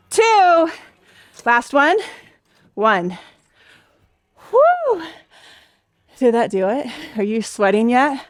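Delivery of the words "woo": high "woo" from the exertion; effort whoop. Real, not acted. Instructor feeling the heavy reps, high effort whoop. Finishes the last rep.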